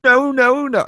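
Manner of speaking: high, loud "no no no" in a put-on diner's voice; mock guy protesting. Co-host asks, host squeaks a high no. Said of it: "that's exactly how he sounded".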